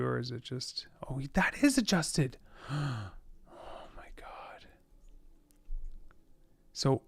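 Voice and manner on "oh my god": gasp and whisper. Real, not acted. Discovering more manual adjustments.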